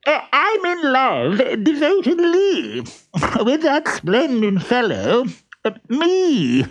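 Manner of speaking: high, muffled character voice; reply high-pitched, as if muffled. A narrator's reading. Shut in a trunk, replying to the question about love, muffled. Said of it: "A muffled voice replied".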